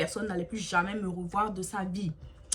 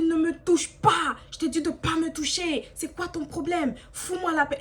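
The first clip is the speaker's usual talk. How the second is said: angry shouting; 6 semitones higher, 3 dB louder than usual. Retold or imitated. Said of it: "je commence à crier sur lui".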